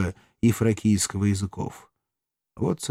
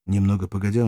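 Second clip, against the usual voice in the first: plain reading voice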